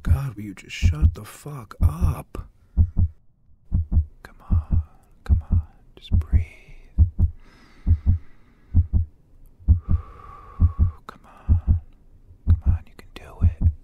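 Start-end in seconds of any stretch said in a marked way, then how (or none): 4.2-13.7 breathless whispering to yourself; urgent whisper. Acted. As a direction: coax yourself through panic in a whisper. Talking himself through panic.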